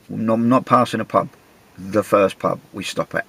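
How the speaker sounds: usual speaking voice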